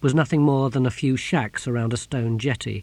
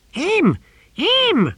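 very high, loud creature shriek; screaming tortoise; 6 dB louder than usual. A narrator's reading. Tortoise spotting the Exquisitor, screaming high. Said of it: "screamed the voice of the tortoise".

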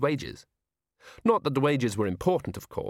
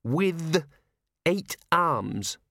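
put-on little girl correcting; 6 semitones higher, much slower than usual. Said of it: "Twyla corrected herself".

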